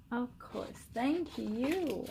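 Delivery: quiet, sincere thanks; tender, touched thank you; soft thank you. Real, not acted. Moved by the signed book.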